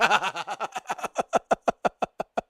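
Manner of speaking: high, helpless run of laughter; long stuttering giggle. Real, not acted. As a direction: keep cracking up until out of air. Cracks up at chat message.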